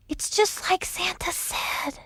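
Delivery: slow, soft unvoiced whisper. A narrator's reading. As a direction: breathe it in awe. Whispered realization.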